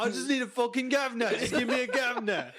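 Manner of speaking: hammed-up plea with "governor" as gav-nah; mock exasperation. Friend mimicking the fed-up customer at the counter, high mock exasperation.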